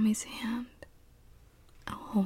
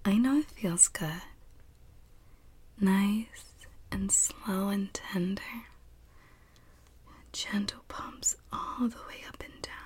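put-on soothing a child; 5 semitones higher than usual. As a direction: hush and comfort, very gently.

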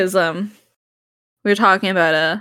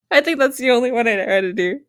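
normal talking voice

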